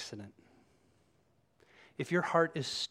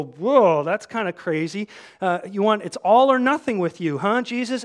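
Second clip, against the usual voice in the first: loud, raised-pitch crowd balking; taken aback; 6 semitones higher, 11 dB louder than usual. Acted. People react to Jesus's hard words, taken aback. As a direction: play the crowd balking, loudly.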